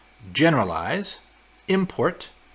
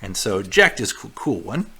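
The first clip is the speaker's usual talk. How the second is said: ordinary talk, nothing marked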